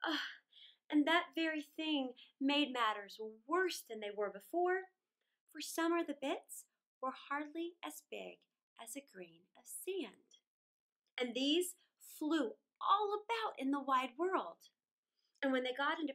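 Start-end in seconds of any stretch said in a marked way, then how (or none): none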